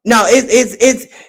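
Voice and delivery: ordinary talk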